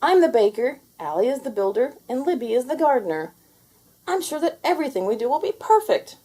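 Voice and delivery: high, bright eager voice; excited character voice. A narrator's reading. Melly assigning the jobs, high and eager. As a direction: rattle it off proudly. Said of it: "cried Melly".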